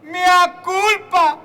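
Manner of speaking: slow, loud anguished mea culpa; hammed-up mea culpa. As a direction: wail it like a penitent.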